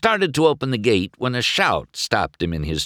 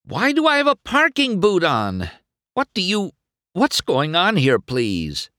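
high, frantic string of questions; alarmed and pleading, dropping on the last word; horrified; 11 semitones higher than usual. A narrator's reading. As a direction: demand answers in a panic. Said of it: "he cried in horror".

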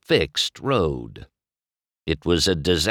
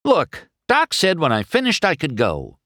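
higher-pitched exasperated protest; frustrated; 9 semitones higher, 3 dB louder than usual. A narrator's reading. McQueen protests that he may go, frustrated. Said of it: "McQueen said in frustration".

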